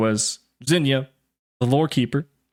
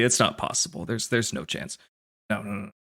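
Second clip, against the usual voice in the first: rambling under breath; 2 semitones lower, 6 dB quieter than usual. Acted. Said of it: "rambling under his breath".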